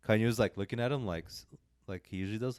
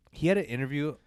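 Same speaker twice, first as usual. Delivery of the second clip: ordinary talk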